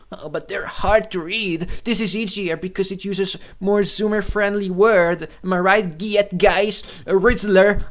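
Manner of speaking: high-pitched mock fan voice; mocking imitation. Imitating fans who find books hard to read, mocking. Mocks people defending the game.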